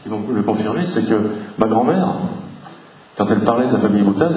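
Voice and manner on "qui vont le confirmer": ordinary talk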